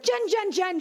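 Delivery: high-pitched Australian call; Australian accent. Retold or imitated. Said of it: "She's from Australia".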